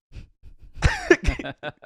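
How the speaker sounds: high loud burst of laughter. Retold or imitated. Bartender laughs at old phrase.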